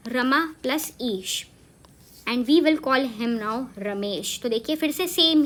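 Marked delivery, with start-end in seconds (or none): 0.1-1.5 teacher lays out each part; slow word breakdown. Shown as a demonstration. Teacher shows students how Ramesh splits, slow and clear. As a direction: slowly spell out रमा plus ईश. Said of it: "उसका नाम simply है रमा".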